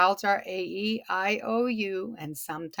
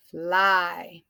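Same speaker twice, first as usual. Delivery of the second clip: FLY stretched through the vowel; drawn out; 3 semitones lower than usual. Shown as a demonstration. Teacher repeating the word for class, FLY drawn out. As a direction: sound out FLY slowly. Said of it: "and it's a short".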